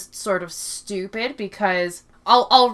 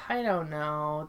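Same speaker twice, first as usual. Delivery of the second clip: softer, lower I don't know; quiet trailing-off; 4 semitones lower, 9 dB quieter than usual. Real, not acted. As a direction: let it drop away resignedly. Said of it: "repeats it differently".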